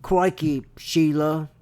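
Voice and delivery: exaggerated Australian, slowing on the question; Australian accent. Relaying an Australian's question in an attempted Australian accent. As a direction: imitate an Aussie, deliberate and drawn out. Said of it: "Do that Australian accent real quick".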